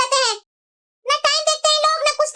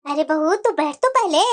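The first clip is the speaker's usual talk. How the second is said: lower, sharp-tongued granny voice; old grandmother; 9 semitones lower than usual. Acted. Grandmother talking to her daughter-in-law, low and sharp. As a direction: play a scolding old grandmother.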